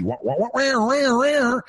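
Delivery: high mock wah wah wah of a zany morning show; wacky wah-wahs. Imitating wacky morning zoo radio with high wah wahs. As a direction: toss off some silly high wah wahs.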